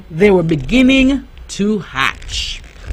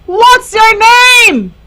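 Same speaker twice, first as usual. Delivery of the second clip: high, slow duckling voice; quacky question; 11 semitones higher, 4 dB louder, slower than usual. A narrator's reading. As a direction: ask eagerly like a little duck. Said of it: "he asked with a quack".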